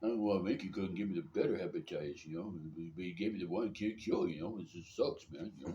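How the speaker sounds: mumbling, griping boxer impression; Rocky Balboa. Sick man griping about Mickey, boxer impression. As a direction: complain like a hapless movie boxer. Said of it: "start sounding like Rocky Balboa".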